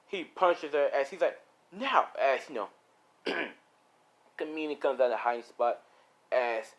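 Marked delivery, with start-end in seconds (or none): 1.7-2.1 high, urgent order; acted commanding cry; sharp command. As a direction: bark one word, pitched up. Shouted order during a fight.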